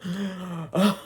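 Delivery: sudden high imitated gasp, rising; mock gasp. The coven rising from body bags, mock gasp. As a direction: gasp like waking in a body bag. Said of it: "They're like".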